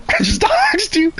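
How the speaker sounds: high-pitched teasing singsong; squeaky mock apology. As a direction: squeak it out like a cartoon sorry.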